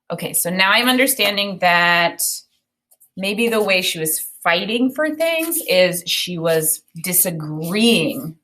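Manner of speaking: ordinary talk, nothing marked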